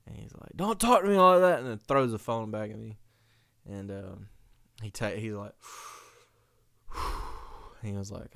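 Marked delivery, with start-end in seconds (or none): 0.6-1.6 high, loud snap; sudden mock-angry outburst; indignant dad. Dad snapping on the phone. 6.9-7.8 soft blown-out breath; voiceless sigh; imitated heavy exhale.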